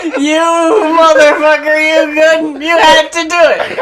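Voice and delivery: high, loud, laughing mock curse; mock outrage. Cohost riffing on the jet sample, mock furious. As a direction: pretend to be furious but laughing. Joking about the jet sound.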